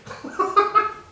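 a high burst of laughter at his own joke; real laugh. Real, not acted. Speaker cracking up at his own patrol-name joke, high laughing burst. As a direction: crack up, unable to hold it in. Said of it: "amused the hell out of me".

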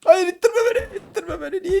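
high whining fake sob with laughter; mock crying. Gamer pushed by another player, mock crying whine.

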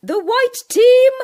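high, loud announcement; teacher naming winners, loud; announcing winners. Acted. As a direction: proclaim the winners.

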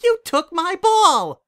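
very high, loud, slow yell; furious shout. Acted. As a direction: scream the accusation. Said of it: "Winona turned her anger on him".

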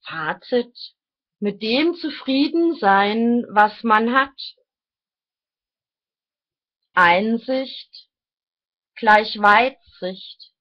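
deliberate, declaimed phrases, each set apart; reading aloud. Real, not acted. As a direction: read your written thoughts out like solemn maxims. Reads own written thoughts aloud.

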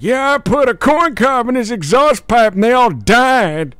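put-on high, fast, loud old-timer bragging; old grandpa voice. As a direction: crank up the wacky grandpa. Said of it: "imitating my grandfather".

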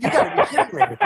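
high-pitched mock disbelief; incredulous protest. Smoker to cop on being arrested, high incredulous protest.

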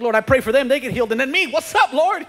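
high, loud mock protest to God; indignant complaint. Believer whose own prayers fail complains to the Lord, indignant complaint.

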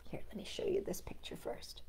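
soft aside whisper. Real, not acted. Storyteller whispering an aside to listeners.

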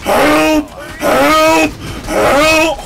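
high, loud repeated yells; shouting for help. Pretend. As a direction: yell for help over and over. Mock cries for help in game.

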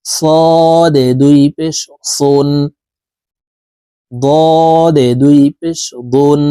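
slow, drawn-out Quran letter recitation; slow recitation drill. Shown as a demonstration. Teacher drilling students in slow tanween recitation. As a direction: chant each letter slowly for students.

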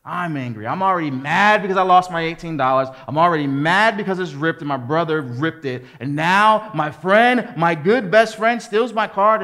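angry retelling, building loud and high; furious. Retold or imitated. A wronged boy fuming over his friend and his card, furious. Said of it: "And I'm furious".

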